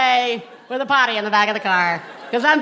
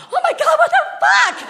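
shocked; 4 dB louder than usual. Retold or imitated. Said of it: "I just was like".

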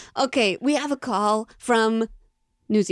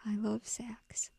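slow breathy whisper; 17 dB quieter, slower than usual. Real, not acted. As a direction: whisper it slowly. Group whispers about the sax.

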